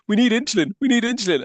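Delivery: high, urgent mock plea; woman radioing for help. Retelling the film's radio distress call, high mock plea. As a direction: pitch it up as a woman calling out over the radio. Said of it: "she's radioing and she's saying".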